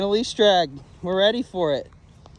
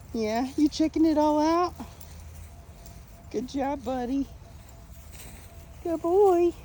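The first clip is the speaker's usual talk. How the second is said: higher, sing-song praise; higher praise to the dog sniffing around; praising dog; 11 semitones higher than usual. Real, not acted. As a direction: encourage the pup gently.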